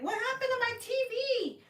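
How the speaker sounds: high mock wail; hammed-up high-pitched alarm; dismayed mom. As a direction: imitate a mom crying out in dismay. Retold mom dismayed again.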